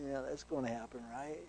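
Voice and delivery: soft, sarcastic "sure it will"; half-laughing at your own prayer; doubting sarcasm. Retold or imitated. Believer mocks their own prayer, softly sarcastic. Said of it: "you just kinda have that little attitude".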